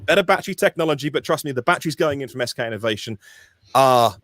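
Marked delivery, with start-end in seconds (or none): 0.0-1.1 mock consoling. Acted consoling reply to disappointed buyers.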